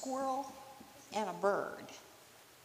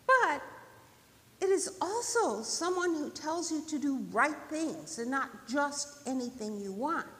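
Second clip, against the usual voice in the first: earnest bird; 6 semitones higher, 5 dB louder than usual. Acted. Bird telling friends what spirit is, earnest. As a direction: start high and loud, then explain.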